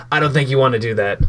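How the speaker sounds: ordinary talk, nothing marked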